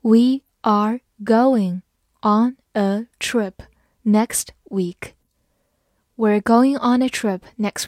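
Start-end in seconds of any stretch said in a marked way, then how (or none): 0.0-5.1 slow, word-by-word model reading; slow teacher's dictation, each word separate. Shown as a demonstration. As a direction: read it out slowly with pauses between words. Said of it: "慢慢来读".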